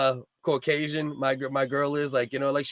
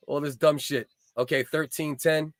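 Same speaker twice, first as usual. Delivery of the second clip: normal talking voice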